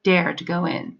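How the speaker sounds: usual speaking voice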